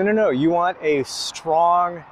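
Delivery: usual speaking voice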